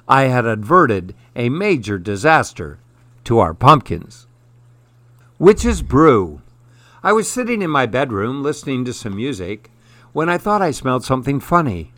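ordinary delivery

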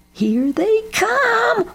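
very high, drawn-out whoop; excited whoop. A narrator's reading. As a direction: whoop the news, stretching the words. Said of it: "whooped Jeb".

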